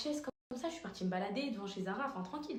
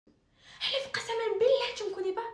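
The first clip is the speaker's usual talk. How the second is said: much higher and louder caricature of the aggressor; aggressive woman's voice; 13 semitones higher, 8 dB louder than usual. Retold or imitated. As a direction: screech it like the furious stranger. (voix de l'agresseuse, très aiguë et forte)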